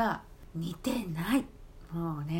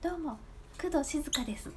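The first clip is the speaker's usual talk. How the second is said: lightly voiced, higher pitch, bad celebrity impression; imitating a bad impression; 5 semitones higher than usual. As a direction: mimic a friend's flat impression, just saying the name. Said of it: "名前言ってるだけっていうね".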